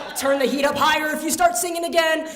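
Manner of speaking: loud, high exasperated yell; angry dad threat. Retold or imitated. Dad to singing kid in hot car, angry threat. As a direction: imitate a fed-up father yelling.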